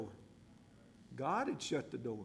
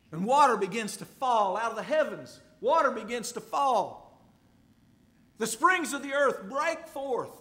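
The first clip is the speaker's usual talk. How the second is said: rising preaching intensity; 6 semitones higher, 8 dB louder than usual. Acted. Preacher vividly describing flood.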